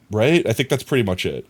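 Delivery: normal talking voice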